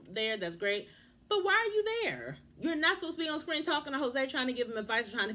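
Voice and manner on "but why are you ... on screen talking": high-pitched, indignant demand; exasperated. Real, not acted. Vlogger questioning the producer's presence, exasperated. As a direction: voice leaps up in disbelief.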